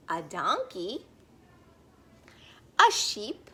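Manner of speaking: slow, sing-song picture-book read-aloud; gentle storybook voice for little listeners; reading to children. Acted. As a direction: read it like story time on the rug.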